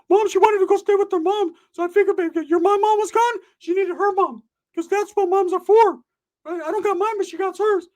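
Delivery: way up high and loud, cocky friend bragging; loud high cartoonish bro voice; high-pitched Ricky voice. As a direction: squeak it out like a boastful buddy. Ricky answers his mom.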